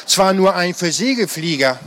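usual speaking voice

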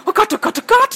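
blurting out in rising alarm; panicked gasp. A narrator's reading. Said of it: "stieß Millie hervor". (panisch hervorgestoßen, immer höher)

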